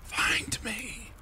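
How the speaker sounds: eerie, drawn-out whisper. A narrator's reading. Sophie, hidden in the house, calls to be found, whispering. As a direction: whisper it softly, barely voiced. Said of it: "you hear her whispering".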